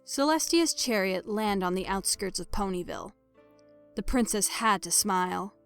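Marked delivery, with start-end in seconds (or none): none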